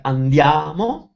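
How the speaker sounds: andiamo drawn out on the A of "-a-mo"; stress on third syllable. Shown as a demonstration. Reading a student's accent question, andiamo stressed late. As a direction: lean slowly on the "a" before "mo" in andiamo. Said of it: "on the third syllable".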